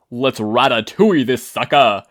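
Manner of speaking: rally the crew with a gleeful shout; yelling. Acted. Banjo rallying the other vestiges with a loud whoop. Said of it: "Banjo yelled".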